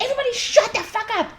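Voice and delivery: high, fast exasperated outburst; high fast mock yell at customers. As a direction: snap at the whole café, half-joking.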